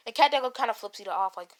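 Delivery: ordinary talk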